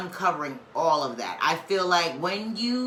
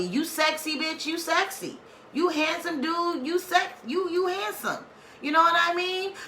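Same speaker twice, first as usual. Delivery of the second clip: high, playful hype-up voice, pitched up all through; pitched-up sassy compliments; 9 semitones higher than usual. Pretend. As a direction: gas them up like a bestie in the mirror. Hyping viewers to love themselves.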